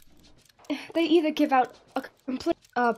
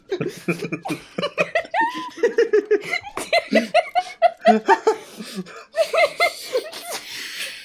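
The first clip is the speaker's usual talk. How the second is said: high, helpless laughter; long laughing fit; 13 semitones higher than usual. Real, not acted. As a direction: crack up completely. Dying of laughter.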